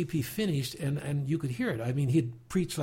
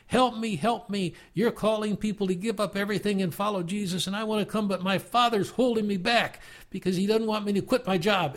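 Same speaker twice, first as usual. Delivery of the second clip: retold with a raised, begging voice; retold pleading holler; 6 semitones higher than usual. Man in the back begging the preacher, raised pleading cry. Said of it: "who hollered out".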